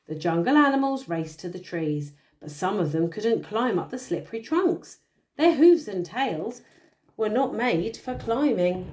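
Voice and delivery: bedtime storytelling voice; story narration. A narrator's reading. Parent reading a picture book to a child, storytelling. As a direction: read the tale aloud to a little one.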